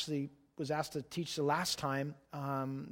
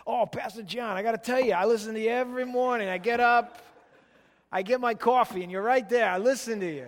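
put-on a radio listener's New Jersey accent, higher and louder; 5 semitones higher, 5 dB louder than usual. New Jersey fan praising the pastor, New Jersey accent. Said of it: "that's the best accent I can do".

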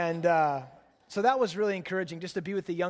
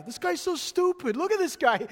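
much higher, loud New York cabbie yell; yelling cab driver; 12 semitones higher, 5 dB louder than usual. Retold or imitated. Taxi driver yelling at the car beside them, mock New York yell. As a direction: bark at the next car like a fed-up cabbie. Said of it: "He's yelling at the guy next to us".

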